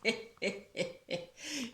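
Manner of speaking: soft, spaced-out staccato chuckle; wolf's sly chuckle. Acted. Disguised wolf enjoying his own prank, sly chuckle. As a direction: chuckle softly in separate pulses.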